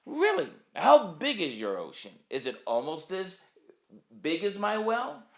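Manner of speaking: frog's voice jumps high in disbelief; a small frog stunned by the idea of an ocean; high, astonished "Really?"; amazed frog. Acted. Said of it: "And he says".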